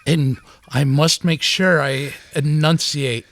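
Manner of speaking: slow, over-enunciated parody; over-enunciated mock. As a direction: spell out every syllable carefully. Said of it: "enunciate every part of every word".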